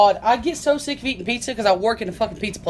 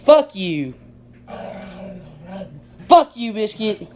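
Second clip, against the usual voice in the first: louder playful fake anger, FUCK punched; mock angry; 3 dB louder, slower than usual. Owner pretending to curse out his dog, loud mock anger. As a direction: pretend to be furious at the dog, just joking.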